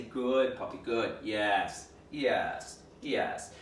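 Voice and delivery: understated soothing praise; calm low praise. Shown as a demonstration. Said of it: "Don't overstimulate".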